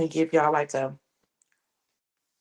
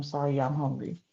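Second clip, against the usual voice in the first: normal talking voice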